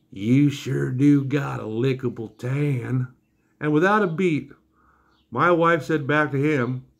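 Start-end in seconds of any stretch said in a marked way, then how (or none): 0.1-3.1 very slow, stretched-out leering drawl; sleazy flirt, exaggerated. [name] repeating come-on about the wife, extra slow mock drawl. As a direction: imitate the creep repeating it, dragging every word.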